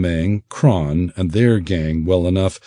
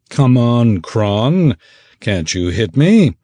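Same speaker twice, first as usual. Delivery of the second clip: loud, cocky taunt at the ogre; brash fighter's jeer across the deck; 4 semitones higher, 5 dB louder, slower than usual. Acted. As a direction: goad him to swing. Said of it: "he taunted".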